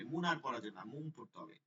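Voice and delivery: ordinary talk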